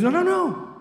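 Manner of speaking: quick high no no no, mock eager; a friend won't let you go to bed yet; eager protest. Friend insists on one more thing.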